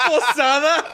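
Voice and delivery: высоко, со смехом, поправляя себя «Posada»; сквозь смех, выше обычного; laughing correction. Real, not acted. Laughing at own mistake.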